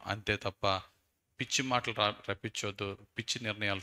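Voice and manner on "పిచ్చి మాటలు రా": normal talking voice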